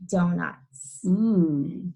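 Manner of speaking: ordinary delivery, nothing marked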